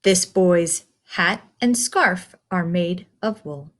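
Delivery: usual speaking voice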